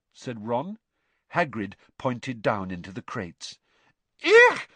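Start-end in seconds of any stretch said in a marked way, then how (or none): 4.2-4.7 very high loud "Eek"; shrill startled squeal. A narrator's reading. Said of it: "squealed Lavender Brown".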